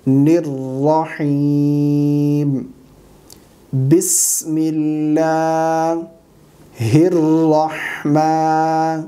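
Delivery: very slow Quranic recitation with long-held vowels; correct recitation. Shown as a demonstration. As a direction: recite it syllable by syllable, drawing out every long vowel. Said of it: "صحیح تلفظات کے ساتھ پڑھ کر سکھائیں گے".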